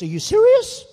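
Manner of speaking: hammed-up amazement at the offer; mock disbelief. [name] reacts to job offer.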